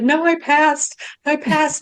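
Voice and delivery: high-pitched mock tearful protest; retold tearful insistence. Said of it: "just crying".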